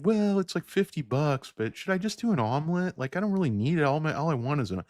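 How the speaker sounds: high, whiny hesitant diner; mock whining. Diner dithering over the buffet price, mock high-pitched whine. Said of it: "you're winning".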